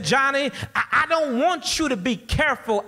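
high-pitched, emphatic correction; imitating a stern neighbor lady; firm mock scolding. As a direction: correct the child firmly. Lady scolding Johnny in story.

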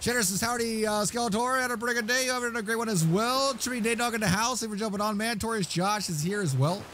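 lightly voiced chat reader. Reading chat in high voice.

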